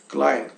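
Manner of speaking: "Klein" with an "ai" glide, closing on N. Shown as a demonstration. Teacher pointing out the pronunciation, German diphthong demo. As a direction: model "Klein" rhyming with English "line". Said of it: "tá aqui a pronúncia".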